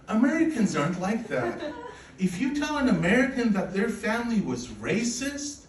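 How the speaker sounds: usual speaking voice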